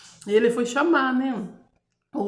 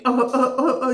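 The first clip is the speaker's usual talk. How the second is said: short barking coughs, imitated; imitated whooping cough; 4 semitones higher, 7 dB louder than usual. Describing the baby's long cough, imitated hacking sounds. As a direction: mimic a cough that won't stop. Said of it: "aquela tosse que fica".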